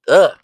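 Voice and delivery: loud, disgusted "ugh"; short burst of frustration; real exasperated "ugh"; exasperated groan. Real, not acted. Exasperated at the slurred talk.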